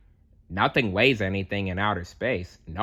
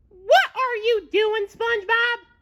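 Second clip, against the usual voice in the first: very high, louder squirrel voice; a squirrel questioning, pitched way up; Sandy voice; 4 dB louder than usual. A narrator's reading. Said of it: "she asked".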